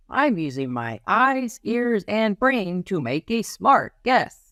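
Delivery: a little detective explaining his method; child detective voice. Acted. Child detective proudly telling how he makes a guess.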